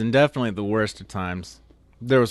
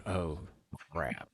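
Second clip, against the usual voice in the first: muttered oh crap; 6 semitones lower, 13 dB quieter than usual. Retold or imitated. Recruit realizing forgot silverware.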